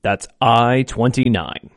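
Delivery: slow, drawn-out number calling; bingo caller. Acted. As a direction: call each number slow and clear.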